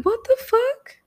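high-pitched disbelieving cry; shocked. Retold or imitated. Told she bullied Lauren, high shocked cry. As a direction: squeak it out in disbelief. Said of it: "I was like".

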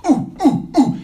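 eager little ooh-ooh, hand up; pleading to be called on; a kid begging the teacher to pick them; eager kid's ooh. Retold or imitated. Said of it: "what we used to do".

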